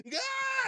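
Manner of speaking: loud, high shocked scream; crowd's shocked yell. Retold or imitated. Imitating everyone's reaction to the slap, shocked yell. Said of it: "everyone was like".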